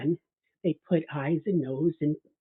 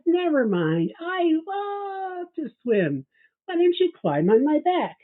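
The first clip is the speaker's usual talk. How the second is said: higher, stretched, sing-song friendly offer; sly wolf; 11 semitones higher, slower than usual. Acted. Wolf luring the gingerbread man onto his back, syrupy high voice. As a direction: coax him sweetly onto your back.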